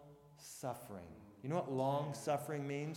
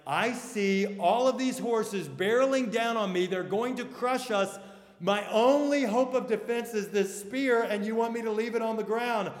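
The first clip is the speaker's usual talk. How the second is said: louder, higher, alarmed objection; panicked protest; 6 semitones higher, 9 dB louder than usual. Retold or imitated. Soldier protesting to Wallace as horses charge, panicked. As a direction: plead against an order that feels insane. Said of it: "you're like".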